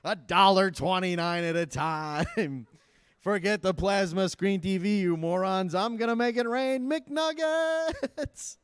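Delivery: hammed-up crook on a spree, stretching the last words; gleeful criminal. Criminals bragging over a stolen card at McDonald's, hammed-up gleeful. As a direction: boast like a reckless big spender, drawing it out. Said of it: "All these criminals".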